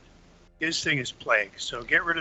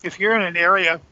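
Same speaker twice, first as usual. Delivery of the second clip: candy in the mouth; mouth full; 4 semitones higher, 6 dB louder than usual. Real, not acted. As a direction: speak around a piece of candy. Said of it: "I gotta take that out of my mouth".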